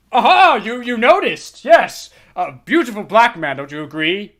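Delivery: a hint of a rattled old man ranting; crude old lawyer. Old lawyer ranting about the girl, mildly worked up.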